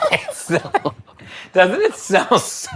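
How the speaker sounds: words breaking into giggles; laughing while talking. Real, not acted. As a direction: talk through helpless laughter. Laughing at the awful smell.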